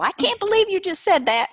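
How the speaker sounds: high, loud hammed-up Southern drawl. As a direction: play the title as an exclaiming Southerner. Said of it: "say them in a Southern drawl".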